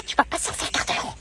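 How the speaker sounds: hushed menace under the breath; whispered threat. Acted. Mother threatening her son in front of his classmate, whispered threat. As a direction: whisper the threat so the girl can't hear.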